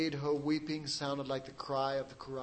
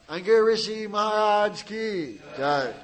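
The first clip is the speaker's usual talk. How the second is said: loud rising call of praise; devotional shout; 4 semitones higher, 8 dB louder than usual. Real, not acted. The preacher leading the congregation, shouting praise. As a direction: cry out the praise. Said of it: "devotional cry".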